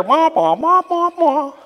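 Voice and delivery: high nonsense wah-wah babble; mock the droning cartoon teacher; Charlie Brown teacher. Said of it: "The, the teacher".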